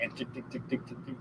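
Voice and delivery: soft ticking noise of the ratchet; whispered ticking. Retold or imitated. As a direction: tick it off under your breath. Bungee chair drawn back.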